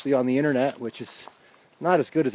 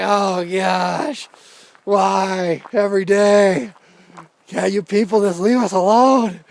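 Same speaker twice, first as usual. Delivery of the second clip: exasperated; 6 semitones higher, 4 dB louder, slower than usual. Retold or imitated. His exasperated retort.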